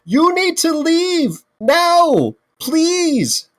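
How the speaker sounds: high, slow, drawn-out insistence; insistent demand. Acted. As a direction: stretch each word out, high and exasperated. Arguing with kobolds.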